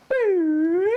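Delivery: high, loud sustained boop; imitated boop. Storyboard artist voicing an effect, loud high boop. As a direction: hold a high-pitched effect noise.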